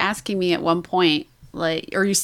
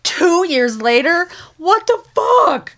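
slow, rising into a much higher, louder cry; exasperated outburst; 10 semitones higher, 6 dB louder than usual. Retold or imitated. Telling the book club about the time jump, exasperated shout. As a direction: build from disbelief to a loud, high shriek.

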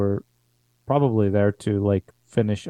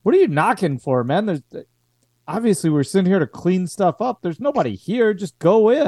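fast, higher, mocking young private; razzing soldier; 7 semitones higher, faster than usual. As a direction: tease him, quick and high. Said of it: "you hear one guy kinda razzing the other".

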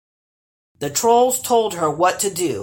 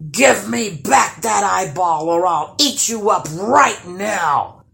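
loud, raised troll voice; angry monster demanding, loud and forceful; threatening troll; 3 semitones higher, 5 dB louder than usual. A narrator's reading. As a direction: bellow it as a menacing troll threat. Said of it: "threatened the first troll".

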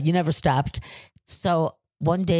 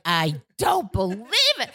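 retold exasperation; 4 semitones higher than usual. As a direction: stretch each word in acted exasperation. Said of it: "I was just so angry".

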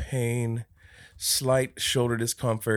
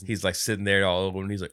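normal talking voice

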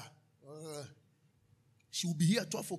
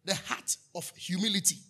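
normal talking voice

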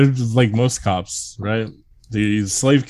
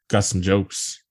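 ordinary talk, nothing marked

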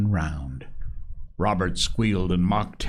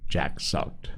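ordinary delivery

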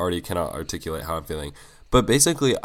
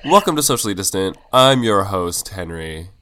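announcer intro; 7 dB louder than usual. Acted. Show intro.

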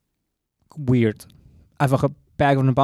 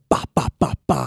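spoken "ba" gunfire noises; imitated gunshots; 14 semitones lower than usual. Storyteller acting out the stranger's threat to shoot, imitated gunshots. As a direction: fire off a row of mimed shots. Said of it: "Er so".

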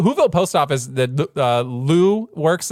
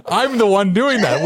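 ordinary delivery

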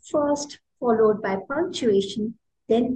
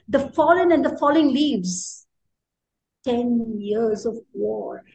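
haiku recitation. Shown as a demonstration.

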